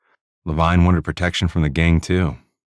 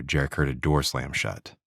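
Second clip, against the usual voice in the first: plain reading voice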